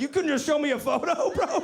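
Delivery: high, laughing complaint; laughing exasperation. Retold or imitated. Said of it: "I was like".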